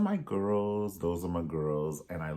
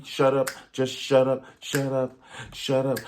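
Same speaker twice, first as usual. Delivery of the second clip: higher, louder shut up, over and over; mock shout; 5 semitones higher, 7 dB louder than usual. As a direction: snap shut up repeatedly in mock anger. Mock telling someone off.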